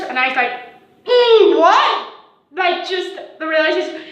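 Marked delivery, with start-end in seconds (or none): 1.1-2.1 high, loud incredulous mm, what; shocked what. Retold or imitated. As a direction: act stunned by the accusation. Shocked reaction to accusation.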